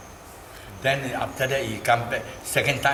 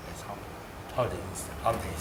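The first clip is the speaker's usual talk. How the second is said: ordinary delivery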